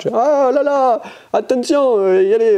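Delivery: high put-on pirate accent. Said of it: "je vais faire comme un pirate".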